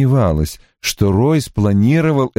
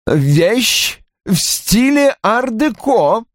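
high, very slow, unamused reply; stern, high-pitched correction; humourless; 12 semitones higher, much slower than usual. A narrator's reading. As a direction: draw it out without a trace of amusement. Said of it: "без улыбки сказал Рой".

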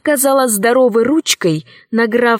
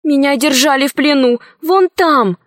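put-on higher-pitched young girl's voice; little girl; 5 semitones higher than usual. Captive girl explains her escape to her uncle, higher girlish voice. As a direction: speak up as a freed captive girl.

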